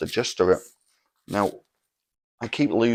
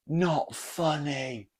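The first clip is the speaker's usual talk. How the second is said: retold heckle; 8 semitones higher, much slower than usual. Friend heckles the third comedian, mock calling out. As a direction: imitate a heckler calling out, slow and high. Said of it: "he shouts out in the middle of it".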